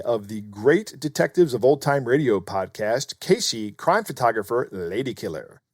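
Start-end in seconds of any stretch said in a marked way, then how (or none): none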